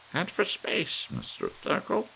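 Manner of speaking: ordering him onward into space; old lady commanding. A narrator's reading. Missus Bellows commands.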